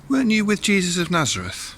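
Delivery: high, loud accusing question; pointed, high-voiced query; servant girl. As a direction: pitch it up like a girl challenging someone. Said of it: "then a servant girl comes to him and says".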